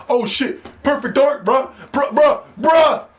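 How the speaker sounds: high, hyped-up fanboy imitation; mock fanboy excitement. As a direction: mimic the fans freaking out, pitch way up. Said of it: "You know how they talk".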